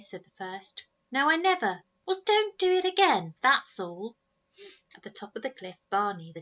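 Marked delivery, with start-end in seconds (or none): 1.1-4.2 high, loud squabble; arguing boys. Acted. Snargett brothers shouting at each other. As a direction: argue back hard.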